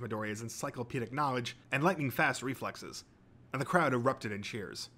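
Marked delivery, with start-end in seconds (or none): none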